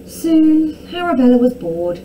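ordinary delivery, nothing marked

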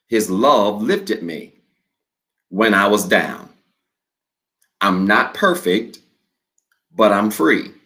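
slow declaration with long pauses for the church to repeat; slow responsive reading. Shown as a demonstration. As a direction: read each phrase slowly and leave a gap after it. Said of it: "I'm gonna read this slow".

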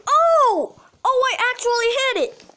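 high, loud, quick burst of surprise; amazed outburst. Real, not acted. Surprised at own successful shot.

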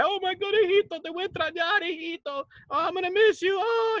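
mock-wail like a clingy mother. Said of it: "She would just cry".

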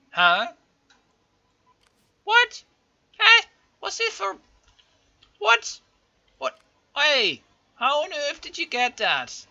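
high, loud, repeated what; outraged shout. Real, not acted. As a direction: yell at the game in real outrage. Outraged at the unexpected rebellion.

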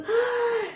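a high, delighted ah of surprise; surprised gasp. Shown as a demonstration. Teacher acting a child surprised by mom, high gasp. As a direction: gasp like mom just came home early. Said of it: "Cuando nos dejamos sorprender".

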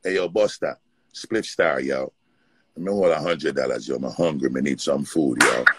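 imitated Jamaican street-corner hustler; low Jamaican-accented plea, tacking on "yo". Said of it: "this Jamaican dude that used to be on the corner".

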